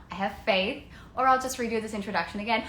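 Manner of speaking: normal talking voice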